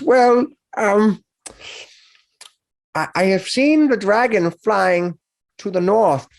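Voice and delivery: put-on a nervous teenager admitting where he went; a young orc telling the heroes about the dragon.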